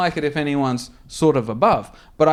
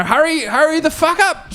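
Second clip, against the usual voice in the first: mock impatient boss; 13 semitones higher than usual. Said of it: "he's like".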